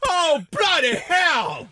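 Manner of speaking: loud, high, slow British cry; British accent, loud shout; mock British accent. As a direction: Brit shocked by the hit. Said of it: "accent britannique".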